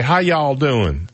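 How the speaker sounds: slow, drawn-out Southern greeting; Southern drawl. Retold or imitated. Michigan kid mimicking a Southerner, slow Southern drawl. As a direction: imitate a Southerner saying hello. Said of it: "we could always spot a Southerner".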